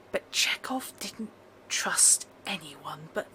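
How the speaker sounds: hushed, overheard whisper. Acted. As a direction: whisper it like a half-heard secret. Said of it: "can get".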